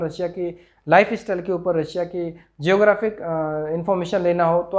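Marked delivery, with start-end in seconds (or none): none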